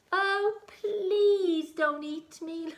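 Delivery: high, loud, slow, begging little voice; pleading spider. Acted. Spider pleading with the troll not to eat him. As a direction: beg for your life as a tiny spider.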